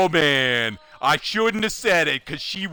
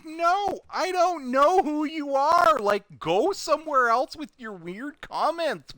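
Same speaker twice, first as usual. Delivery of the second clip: dismissive; 10 semitones higher, slower than usual. Retold or imitated. Dismissive reply.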